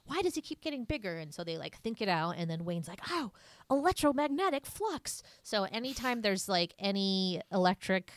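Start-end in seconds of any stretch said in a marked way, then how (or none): 0.0-1.2 high, quick, bewildered retelling; baffled mock question. Retelling the movie parents' confusion, baffled. 3.1-5.2 high put-on eureka voice; sudden realisation. Quoting Wayne working it out, mock eureka.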